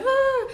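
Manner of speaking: high, loud "ah" of inspiration; awed inspired gasp. Retold or imitated. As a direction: let out a high, sudden "ah" of wonder. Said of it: "I was so inspired".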